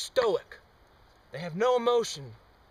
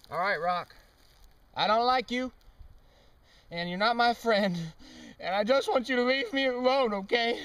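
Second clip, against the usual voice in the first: higher, louder, hammed-up telling off; mock angry rejection; 7 semitones higher, 4 dB louder than usual. Scolding the rock to test the meter, mock angry. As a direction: shove the rock away with petulant words. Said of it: "Let's try some negative techniques".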